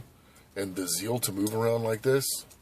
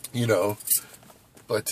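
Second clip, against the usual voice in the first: usual speaking voice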